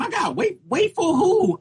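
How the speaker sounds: high incredulous whine; storyteller reliving the gas-station wait with high incredulity. Retold or imitated. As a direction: act out the disbelief of having to wait. Retold disbelief at waiting.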